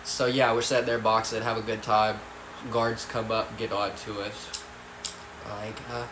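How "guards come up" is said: ordinary delivery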